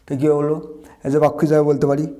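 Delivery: ordinary talk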